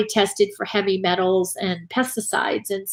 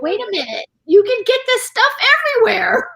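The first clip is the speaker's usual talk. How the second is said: incredulous; 11 semitones higher than usual. Retold or imitated. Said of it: "And you're like".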